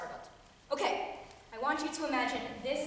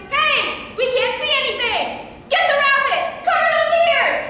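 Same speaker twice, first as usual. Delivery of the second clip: high, full-force heckling shouts; shouting spectators; 12 semitones higher, 13 dB louder than usual. Acted. Angry back-row audience at the rabbit, loud high shouting. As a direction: yell from the back rows. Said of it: "People sitting in the back rows are shouting".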